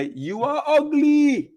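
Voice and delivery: high, slow shouted insult; mock shout from far away; shouting from afar. Shown as a demonstration. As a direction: yell it across the room. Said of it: "and just shout".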